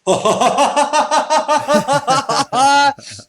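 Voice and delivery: long, high-pitched manic laugh; wild staccato cackle, building; hysterical laughter. Acted. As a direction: act out weeping tipping into hysterical laughter. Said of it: "starts laughing so hysterically".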